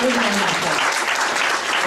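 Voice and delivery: "Big round of applause" stretched out slowly, each word lengthened; slow it right down and lean on every word; drawn-out repeat. Real, not acted. Repeating the applause request to the crowd, slow and emphatic.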